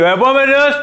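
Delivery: high-pitched call for the next one. Acted. Interviewer dismissing applicant, calling out for the next one.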